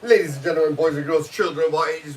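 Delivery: announcer opening. Pretend. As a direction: boom it out like a circus announcer. Mock ringmaster show opening.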